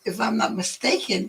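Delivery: ordinary talk, nothing marked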